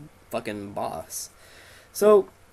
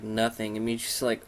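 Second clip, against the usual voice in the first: normal talking voice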